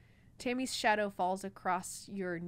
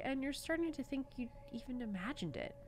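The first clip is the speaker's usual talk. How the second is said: usual speaking voice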